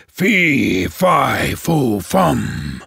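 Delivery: low, slow giant chant; ogre voice. Acted. The ogre chanting as he comes in, low. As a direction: boom out the ogre's chant.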